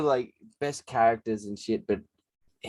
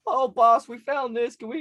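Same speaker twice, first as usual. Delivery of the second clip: high-pitched eager sidekick on the phone; pestering crackhead caller; 12 semitones higher than usual. Retold or imitated. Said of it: "ring you, and it's like".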